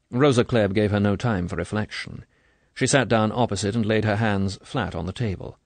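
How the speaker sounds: ordinary delivery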